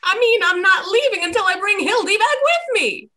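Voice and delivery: determined. Acted. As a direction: dig in your heels.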